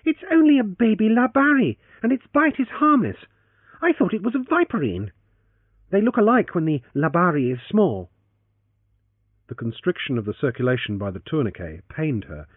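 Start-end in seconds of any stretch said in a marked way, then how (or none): 0.0-8.1 put-on high young woman explaining; girl explains the snake was harmless, flustered; heroine's voice. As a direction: set him straight, a little flustered.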